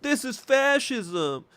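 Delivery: high, drawn-out mock whine of protest; mocking Democrats. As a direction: imitate complaining Democrats in a slow, high-pitched voice. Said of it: "Democrats being like".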